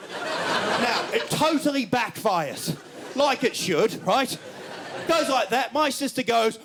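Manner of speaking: ordinary talk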